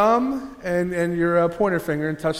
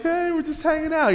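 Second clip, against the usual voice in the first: lingering guests; 9 semitones higher than usual. Retold or imitated. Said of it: "And they're like".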